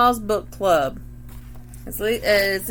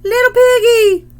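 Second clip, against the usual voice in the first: shrill, much higher and louder parrot squawk; 12 semitones higher, 10 dB louder than usual. A narrator's reading. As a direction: screech it like a parrot. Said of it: "And the parrot squawks".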